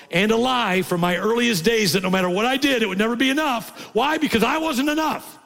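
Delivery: high, loud testimony preaching; full-force preaching. Acted. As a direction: cry it out as testimony.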